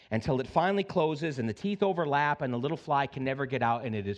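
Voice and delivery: usual speaking voice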